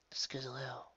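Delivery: whispered name, a fading memory; ghostly whisper. A narrator's reading. Girl hearing her mother's voice in memory, whispered. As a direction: breathe it like an echo.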